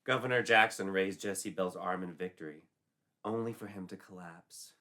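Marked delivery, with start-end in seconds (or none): none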